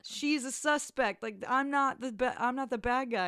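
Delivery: high-pitched mock pleading; retold last-ditch plea. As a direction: imitate a cornered man insisting on innocence. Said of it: "in one last attempt".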